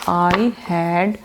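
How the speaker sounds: "I had great fun yesterday" with long gaps, yesterday drawn out; word by word. Shown as a demonstration. Teacher showing students how to say it, slow drill. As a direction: drill it slowly, one word at a time. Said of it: "तो आप कहते हो".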